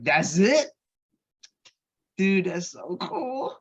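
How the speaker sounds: wide-eyed amazement; retelling his excited younger self. Retold or imitated. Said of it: "I was so excited".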